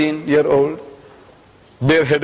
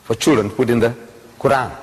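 ordinary talk, nothing marked

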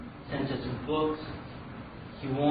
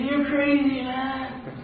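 high-pitched exasperated dismissal; mock disbelief in a high voice; acted incredulity; 10 semitones higher, much slower than usual. Canoeists to stubborn man in flood, incredulous.

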